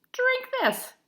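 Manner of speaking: a hint of a firm granny; lightly voiced Grandma. A narrator's reading. Grandma gives medicine.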